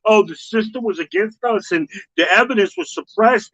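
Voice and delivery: high, mock-innocent complaint; high mocking whiny voice; mocking whine. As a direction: whine it like a self-pitying victim. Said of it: "make it seem like".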